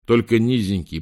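ordinary delivery

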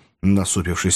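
ordinary delivery

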